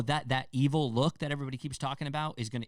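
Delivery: normal talking voice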